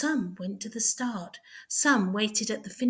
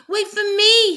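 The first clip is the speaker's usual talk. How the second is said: very high, loud desperate cry; hare crying out; 12 semitones higher, 12 dB louder than usual. A narrator's reading. The hare running after the tortoise, crying out high and loud. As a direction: yell after the tortoise in panic. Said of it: "he cried".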